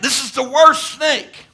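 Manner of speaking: slow and loud, leaning on worst; preaching emphasis. Real, not acted. Preacher to congregation, slow loud stress on worst. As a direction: hammer the word worst, slow and heavy. Preacher driving home the point.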